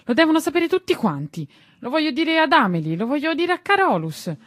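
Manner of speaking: put-on very high, loud boy's cry; shouting. Tistù vowing to tell everyone, high loud shout. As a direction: call it out for everyone to hear. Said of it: "gridò".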